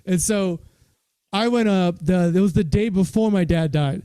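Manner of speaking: ordinary talk, nothing marked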